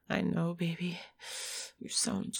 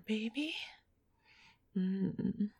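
ordinary delivery

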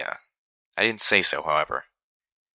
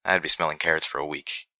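plain reading voice

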